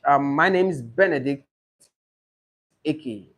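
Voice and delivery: slow, syllable by syllable; name said slowly. Shown as a demonstration. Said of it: "Please say it slowly and break the names into syllables".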